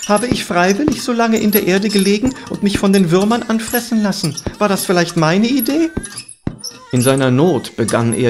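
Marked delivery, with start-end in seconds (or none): 0.1-5.9 high, indignant protest; crying out. A narrator's reading. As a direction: protest your innocence in a high voice. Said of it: "schrie der Läufer".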